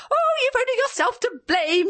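very high, shrill and triumphant; triumphant shrill bugle. A narrator's reading. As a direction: crow it out like a shrill old wife. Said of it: "she bugled out triumphantly".

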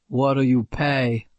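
put-on low tough kid voice. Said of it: "voice was as low as a high schooler's".

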